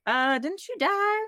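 high-pitched mock-puzzled questioning; puzzled, high imitation of a nitpicking fan; puzzled viewer. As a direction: voice a confused viewer, pitched well up. Imagined puzzled viewer questioning the plot.

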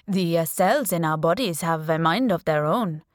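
put-on strong Indian accent, lecturing doctor. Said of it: "he said in a strong Indian accent".

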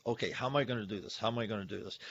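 soft, fast planning under his breath; quietly figure it out, hurrying; hushed quick self-talk; muttering to himself. Retold or imitated. Hunter plotting quietly to himself.

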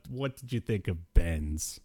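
ordinary talk

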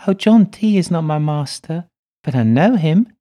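put-on high, loud little girl voice; a spirit child speaking through a medium; little girl's voice. A narrator's reading. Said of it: "In Madimi's voice she answers".

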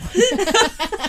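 loud high burst of laughter. Real, not acted. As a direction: crack up laughing. Laughs at own joke.

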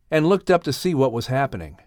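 usual speaking voice

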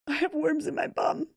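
high, whimpering mock distress; mock tearful confession. Waking husband to confess worms, mock tearful squeak. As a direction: squeak it out like you're about to cry.

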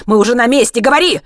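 high, loud urgent demand; shouting. A narrator's reading. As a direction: shout it, climbing to the final command. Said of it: "закричал Хайман". (громко крича, требуя ответа)

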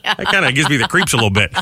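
ordinary talk, nothing marked

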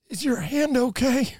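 high, slow, pained mock concern; doubled over, winded. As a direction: ask it as if just punched in the stomach. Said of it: "as I'm doubled over and I'm squinting, I said".

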